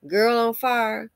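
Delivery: usual speaking voice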